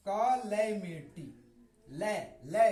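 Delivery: slow कैलेमिटी as 'kuh-LAE-mity', then isolate ले; correct pronunciation. Shown as a demonstration. Teacher breaks the word down for students, correct pronunciation. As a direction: drill ले firmly with an open 'ae'. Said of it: "इसको पढ़ेंगे ले".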